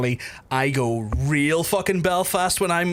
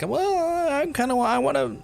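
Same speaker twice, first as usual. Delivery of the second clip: acted hesitant curiosity; 12 semitones higher than usual. Replying to a boast about Omaha steaks, comically tempted. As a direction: play up being tempted, stalling high.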